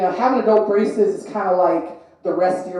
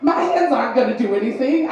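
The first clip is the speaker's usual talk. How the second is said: ordinary delivery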